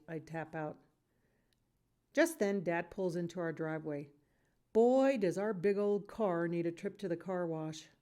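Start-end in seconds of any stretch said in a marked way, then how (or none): none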